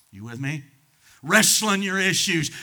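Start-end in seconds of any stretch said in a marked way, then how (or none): 1.2-2.5 louder emphatic repeat. Acted.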